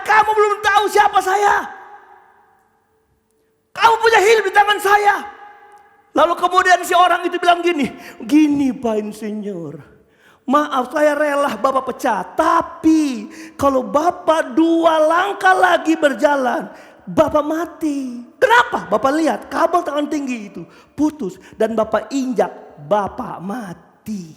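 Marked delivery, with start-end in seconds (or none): 0.0-5.2 high, loud threatening shout; angry boss. Acted. Engineer threatens the worker's livelihood, shouting angrily. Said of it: "Ini Pak Insinyur marah". 8.2-18.2 slow, weighty explanation; measured pleading. Acted. Worker explains himself to furious boss, slowly and earnestly. 18.9-24.3 grave explanation. Acted. Worker explains the deadly cable to his boss, gravely.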